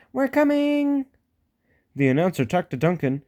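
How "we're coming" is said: high eager engine voice; little engine Peter Sam. A narrator's reading. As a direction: call out high and cheerful.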